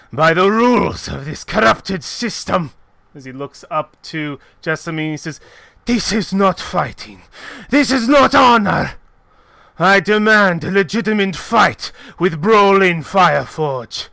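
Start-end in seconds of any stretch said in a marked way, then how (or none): 0.1-2.7 loud, indignant fighter; angry denunciation. Acted. Fighter condemns the arena's rules, loud and angry. 5.9-14.1 raised, loud furious demand; outraged protest. Acted. Fighter demands a fair fight from the mistress, outraged.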